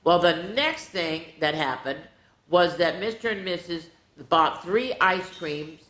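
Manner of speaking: plain reading voice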